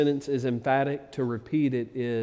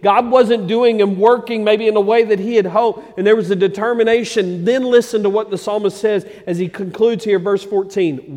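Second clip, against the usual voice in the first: loud, raised sermon voice; full-force preaching; 7 semitones higher, 10 dB louder than usual. Real, not acted. Preacher voicing the psalmist's resolve to his congregation, full-force preaching. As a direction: preach it hard with driving stresses.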